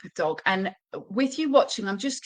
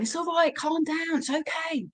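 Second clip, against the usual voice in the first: high, fading, worried coo; anxious reassurance; 9 semitones higher than usual. Shown as a demonstration. Owner fussing over the dog, high and tense. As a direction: soothe the dog while sounding panicked, pitch way up. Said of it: "not saying".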